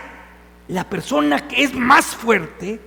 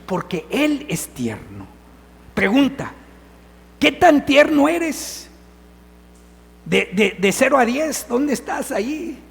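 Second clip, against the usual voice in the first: normal talking voice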